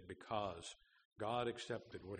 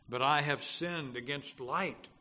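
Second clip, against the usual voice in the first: loud, higher-pitched objection; insistent raised-voice confession; protesting sinner; 5 semitones higher, 7 dB louder than usual. Acted. Sinner's objection in quoted dialogue.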